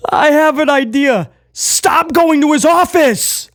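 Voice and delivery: mock-solemn Priest line; sincere Priest impression. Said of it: "the sincerity in his voice".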